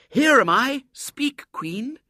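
put-on high, loud stage recital in a child's voice; reciting fairy line. A narrator's reading. Girl demonstrating the fairy's line, high recital. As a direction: declaim it in separated phrases. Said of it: "come out and bow and say".

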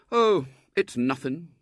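slow, offhand modesty; modest boy. A narrator's reading. Boy brushing off a girl's thanks, modest. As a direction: wave away the thanks slowly. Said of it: "he said modestly".

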